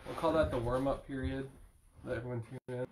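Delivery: ordinary talk, nothing marked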